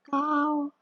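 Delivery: "Kau" held high and level, first tone. Shown as a demonstration. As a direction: keep "Kau" flat and high. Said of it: "Nada satu".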